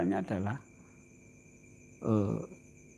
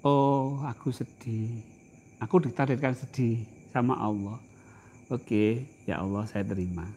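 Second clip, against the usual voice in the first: accepting calmly; 7 semitones lower, 7 dB quieter than usual. Retold or imitated. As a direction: speak it softly as a prayer of acceptance. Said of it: "Nek sedih".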